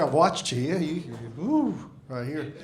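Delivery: ordinary delivery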